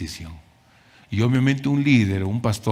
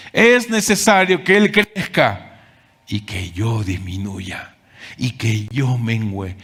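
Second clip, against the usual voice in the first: solemn quoted scripture; 3 dB louder, slower than usual. Acted. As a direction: proclaim the verse, then humble yourself. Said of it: "las palabras de Juan el Bautista". (cita bíblica solemne y lenta)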